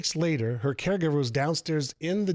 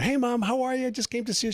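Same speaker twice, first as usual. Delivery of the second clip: high, bright-pitched hello to a parent; retold chirpy son's hello; cheery greeting to mom; 10 semitones higher, faster than usual. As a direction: greet your mom in a raised, upbeat voice. Son greets his depressed mother.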